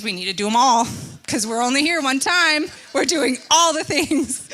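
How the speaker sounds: over-keen trip planner laying down the plan; insistent eagerness. Acted. Acted vacation eagerness.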